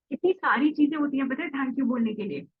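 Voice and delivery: usual speaking voice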